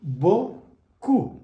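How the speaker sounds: beaucoup as "bo-koo", in two separate beats; French beaucoup. Shown as a demonstration. Teacher builds the word for learners, beaucoup as bo-koo. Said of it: "It becomes beaucoup".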